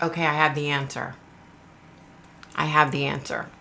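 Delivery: low, hushed psychic imitation; psychic's channeling act. Imitating a psychic who has received the answer, low and hushed. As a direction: announce it low and softly, as if receiving it. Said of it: "let me see if I can do it".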